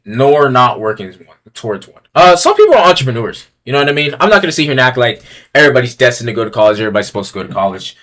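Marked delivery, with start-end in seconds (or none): none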